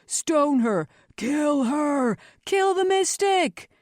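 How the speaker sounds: bay for blood as a crowd; mob calls. A narrator's reading. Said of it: "other voices called for more extreme measures".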